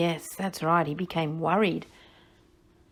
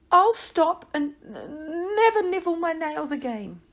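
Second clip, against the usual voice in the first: tearful promise; 8 semitones higher, 3 dB louder than usual. Acted.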